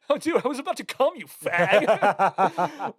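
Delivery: high, fast mock father line with a laugh; hammed-up quote tumbling out laughing; Robin Williams quote. Retelling the favorite line again, high and laughing. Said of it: "it's just".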